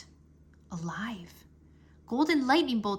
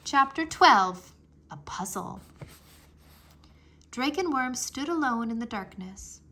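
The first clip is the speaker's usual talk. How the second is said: plain reading voice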